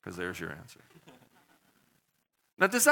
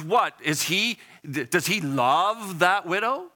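ordinary delivery

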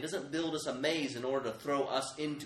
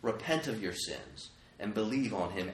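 ordinary delivery

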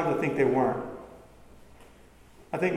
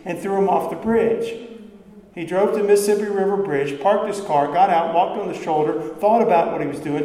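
ordinary delivery

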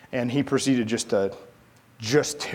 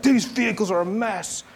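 mock angry commander; 8 semitones higher than usual. Commander voiced in story.

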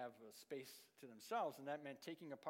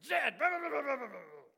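put-on high, loud impatient outburst at the boy; frustrated yell; 8 semitones higher, 12 dB louder than usual. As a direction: snap loudly at the nine-year-old in frustration. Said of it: "suddenly I'm like".

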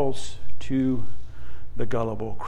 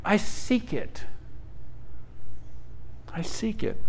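quiet resolve; 3 semitones higher, 8 dB quieter than usual. Retold or imitated. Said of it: "actually saying".

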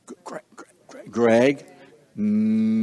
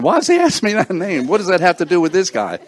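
quick, higher, louder mock puzzlement; imitating a puzzled man; 7 semitones higher, 4 dB louder, faster than usual. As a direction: rattle off his confused thoughts, fast and high. Voicing the man's puzzled thoughts.

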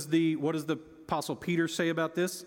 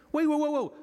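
playful startled interruption; mock alarm; 7 semitones higher than usual. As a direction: pull up short in mock shock.

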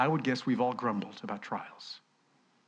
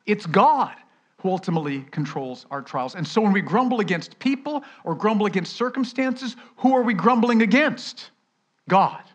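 loud, higher ringing proclamation; preaching at full force; 8 semitones higher, 7 dB louder than usual. Acted. Preacher thundering the answer at the church. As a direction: drive it home to the back row.

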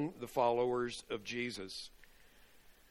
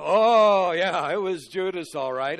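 mock knowing shout; 5 semitones higher, 13 dB louder than usual. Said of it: "they didn't all go".

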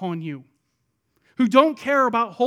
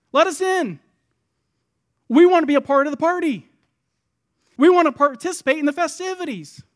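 loud, higher-pitched plea to be let in; insistent pleading; 6 semitones higher, 7 dB louder than usual. Acted. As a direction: call through a locked door, raised and urgent. Acting out locked-out bridesmaids pleading.

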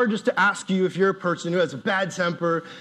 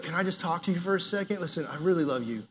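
gentle approach; 2 semitones lower, 7 dB quieter, faster than usual. Retold or imitated. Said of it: "you stopped and you're like".

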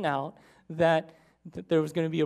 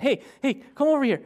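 loud, higher-pitched excited yell; calling out; 4 semitones higher, 6 dB louder than usual. Retold or imitated. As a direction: yell to someone in the back room. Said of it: "he yells over to his wife".

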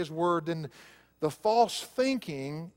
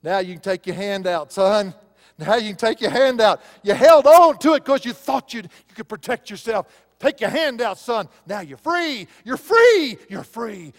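booming, higher and much louder; God's triumphant voice; 7 semitones higher, 12 dB louder than usual. Retold or imitated. The preacher voices God telling him he is free, booming and rising. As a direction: proclaim freedom like a joyful father releasing his son. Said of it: "And you know what God told me?".

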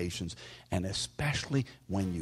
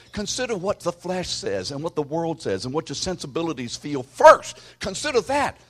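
mock voice of the flesh; 7 semitones higher, 8 dB louder than usual. Preacher voices the flesh's demands.

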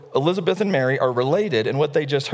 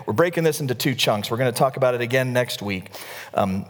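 ordinary talk, nothing marked

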